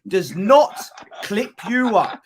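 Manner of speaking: ordinary talk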